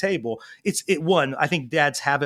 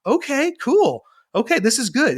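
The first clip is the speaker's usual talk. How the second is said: put-on reassured kid; 9 semitones higher, 4 dB louder than usual. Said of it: "look on his face is like".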